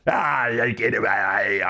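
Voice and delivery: put-on thick Aussie accent, tossed off; hammy Aussie bloke; exaggerated Australian accent. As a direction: wrap up the broad Australian bit. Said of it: "speaking with this accent".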